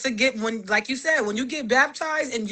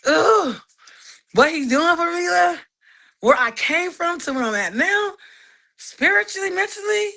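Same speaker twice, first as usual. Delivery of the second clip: higher, rising awe; overwhelmed praise; 6 semitones higher than usual. Retold or imitated. Thanking God for her growth, overwhelmed. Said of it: "So it's like".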